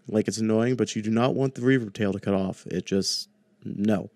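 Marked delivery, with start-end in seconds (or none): none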